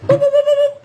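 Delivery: boop boop sounds, high-pitched and loud; high, loud mock beeping noise. A torture victim mocks his captors with loud high beeping. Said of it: "and then just went".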